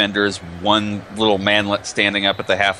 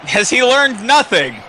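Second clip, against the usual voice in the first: exasperated; 13 semitones higher, 5 dB louder, slower than usual. Acted. Mock exasperated commentary.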